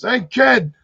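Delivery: put-on high tough-guy hey kid; lightly hammed mob boss calling; mock mobster. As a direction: call up to the kid like a wiseguy. Mob boss calls up tree.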